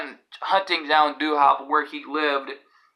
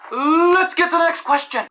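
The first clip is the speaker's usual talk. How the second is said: pitched way up, playful host call; announcer-style segue; 13 semitones higher, 4 dB louder than usual. Pretend. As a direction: launch the next segment in a high voice. Host's catchphrase segue between questions.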